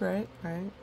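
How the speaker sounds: plain reading voice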